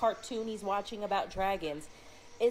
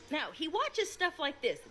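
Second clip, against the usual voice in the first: ordinary delivery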